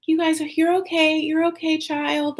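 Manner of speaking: high-pitched cooing reassurance; acted high, motherly soothing; soothing a child. Voicing comforting a child.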